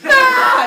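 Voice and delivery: very high, loud shriek; mock scream. Leto seeing the giant python, screaming. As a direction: burst into a cartoon scream. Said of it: "She goes".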